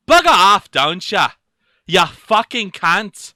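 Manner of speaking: loud, high Aussie insult, slowing at the end; Australian accent. Shown as a demonstration. Australian accent, showing what you can say in public. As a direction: hurl it like a rowdy Aussie, last words slow. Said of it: "You have a good English accent".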